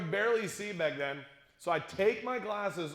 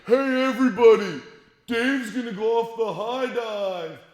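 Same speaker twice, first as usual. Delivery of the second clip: loud, higher yell to the whole crowd; loud announcing; 5 semitones higher, 10 dB louder than usual. Retold or imitated. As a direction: call it out to everybody. Friend loudly announces the dare.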